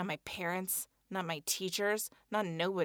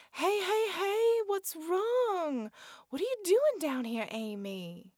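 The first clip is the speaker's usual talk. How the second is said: Sam soothing; 10 semitones higher, slower than usual. Acted. Sam comforts crying Amy.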